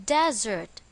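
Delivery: "desert" with the stress on its first syllable, DEZ-ert. Shown as a demonstration. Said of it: "the stress is on the first syllable".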